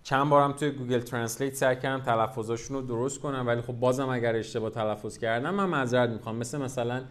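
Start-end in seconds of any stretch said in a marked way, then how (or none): none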